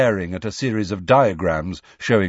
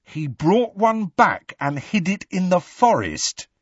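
high, astonished question; total incredulity; 10 semitones higher than usual. A narrator's reading. Ron reacts to the story of Hagrid's giant, incredulous. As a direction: echo it back in high-pitched disbelief. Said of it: "replaced by a look of total incredulity".

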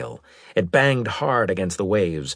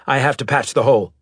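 quick, raised order; commanding; 5 semitones higher, 5 dB louder, faster than usual. A narrator's reading. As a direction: tell the pilot fast and with authority. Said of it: "said in a commanding voice".

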